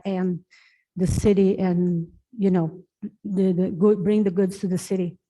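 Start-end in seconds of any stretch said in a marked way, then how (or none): none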